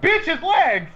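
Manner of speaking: high, exasperated retort; a joking outraged yell at Mystique; mock outrage. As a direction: snap back in disbelief.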